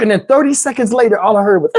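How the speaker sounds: ordinary delivery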